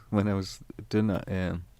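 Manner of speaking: ordinary talk, nothing marked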